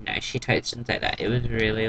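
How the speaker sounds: ordinary talk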